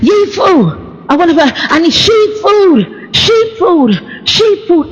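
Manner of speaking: put-on high, demanding childish voice; whiny childish demand. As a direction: whine for food like a child. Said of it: "so it's like".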